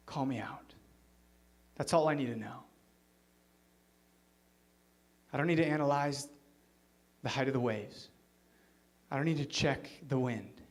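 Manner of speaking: low, soft, intimate pleading; almost whisper the request; a quiet, earnest prayer; hushed plea. Retold or imitated. Peter's quiet plea to Jesus.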